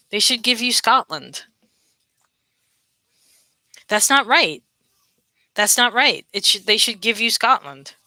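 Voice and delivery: usual speaking voice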